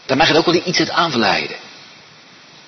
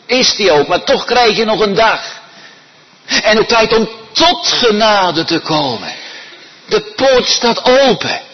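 high, loud urgent preaching; preaching at full force; 10 semitones higher, 5 dB louder than usual. Acted. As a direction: urge the congregation, pleading.

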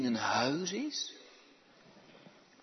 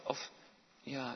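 usual speaking voice

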